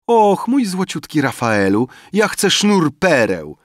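high, loud wheedling plea; excited exclamation. A narrator's reading. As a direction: exclaim your wish to a darling benefactor. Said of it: "wykrzyknęła Eufrazja". (wysoki, przymilny okrzyk)

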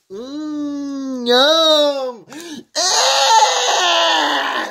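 mock crying. Pretend eating a worm.

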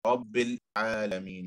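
رب then a half-second pause before العالمين; pause after Rabb. Shown as a demonstration. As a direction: hold a short pause after رب. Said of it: "and then half a second pause".

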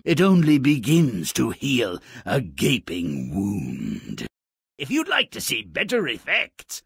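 normal talking voice

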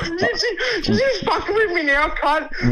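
very high disbelieving voice; can't believe what he's hearing; incredulous. Real, not acted. Suspects the call is a prank.

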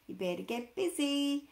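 plain reading voice

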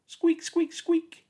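very high squeaking; squeaky shoe noise. Acted. Narrator imitates the squeaky trainer, high squeaks.

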